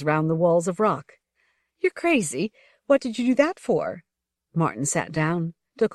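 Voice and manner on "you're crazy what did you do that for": high, upset scolding; exasperated scolding. Acted. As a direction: tell him off, alarmed. She scolds him, soaked.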